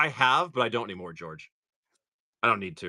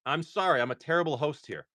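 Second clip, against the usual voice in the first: usual speaking voice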